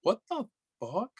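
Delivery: high-pitched, incredulous retelling; acted disbelief. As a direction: replay your disbelief in a high voice. Retelling his disbelief at accusation.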